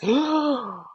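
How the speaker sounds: high, drawn-out gasp of realisation; acted gasp. Retelling a touch of love at the retreat, acted gasp. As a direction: gasp as if it just dawned on you.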